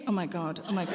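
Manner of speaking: low, hushed mock mortification; small, low voice of someone mortified; imitating embarrassed wife. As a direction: mutter it softly, acting embarrassed. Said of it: "she always gets embarrassed".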